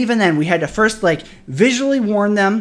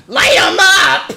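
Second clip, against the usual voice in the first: high, loud outraged shout; real outraged yell; furious shout; 11 semitones higher, 7 dB louder, slower than usual. Real, not acted. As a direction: let the anger burst out. Peak of furious rant at police.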